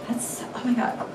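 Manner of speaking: breathy hushed whisper, almost no voice; whispered oh my God. Real, not acted. Vlogger whispering to viewers, awed by her plan. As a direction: let it out under your breath in awe. Overwhelmed by her own idea.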